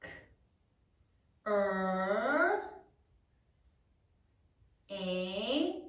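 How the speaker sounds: drawn-out isolated sounds, long pauses; dictation sound by sound. Shown as a demonstration. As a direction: say K, Er, Age as if writing. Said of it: "say it as you write it".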